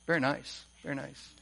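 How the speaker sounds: soft, offhand mock praise, fading almost to nothing; golf-clap mock praise. Pastor mimics a friend's golf clap toward Jesus, mock offhand murmur. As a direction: murmur it like a polite golf clap, barely bothered. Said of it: "like the golf clap".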